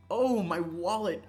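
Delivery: ordinary talk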